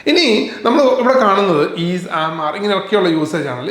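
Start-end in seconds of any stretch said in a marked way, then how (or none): none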